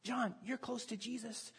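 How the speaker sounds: hushed, softened aside; acted whisper. Peter leaning over to John at the table, hushed aside. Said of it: "Peter leans and whispers to John".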